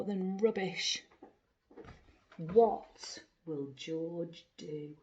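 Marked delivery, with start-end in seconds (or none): none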